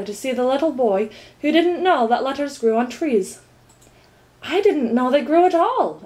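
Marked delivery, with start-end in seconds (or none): none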